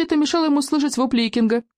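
plain reading voice

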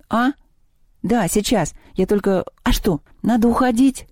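reluctant, rising complaint; plaintive question. A narrator's reading. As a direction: ask it a little piteously. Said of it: "спросил Севка жалобно".

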